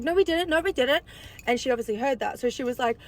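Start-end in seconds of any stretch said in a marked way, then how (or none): 0.0-1.0 imitating the ex, higher and a bit louder; mock a guy caught out, denying fast; panicked denial. Cheating ex denies on speaker.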